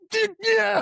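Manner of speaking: very high, whooping dude yeah; hammed-up cheer. Podcaster mock-cheering the hero's frustration, very high. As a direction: cheer like a frat buddy. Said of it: "It's just like".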